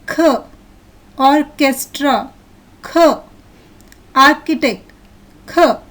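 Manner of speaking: each word followed by a puffed, isolated "kuh" for "kh". Shown as a demonstration. Teacher showing children CH as k, emphatic spaced-out drill. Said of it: "C H sound you can hear as kh".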